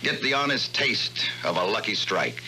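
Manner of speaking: ordinary delivery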